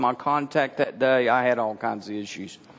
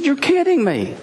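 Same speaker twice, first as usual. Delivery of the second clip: stunned disbelief; 13 semitones higher, 4 dB louder than usual. Retold or imitated. Retold shock at grandmother's belief.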